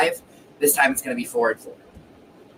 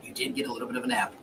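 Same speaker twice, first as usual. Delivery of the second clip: quick breathy whisper; 10 dB quieter, much faster than usual. Pretend. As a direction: whisper it like a secret aside. Whispered repeat of the tease.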